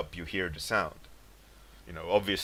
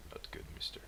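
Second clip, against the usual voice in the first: whispered, much softer tease; mock whispered warning; 17 dB quieter, faster than usual. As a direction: breathe the pretend reprimand. Mock scolding the late viewer.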